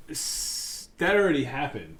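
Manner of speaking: ordinary talk, nothing marked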